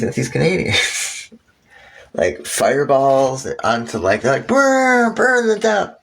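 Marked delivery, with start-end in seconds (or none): 4.5-5.9 mock raider cry. Acted raiders burning town.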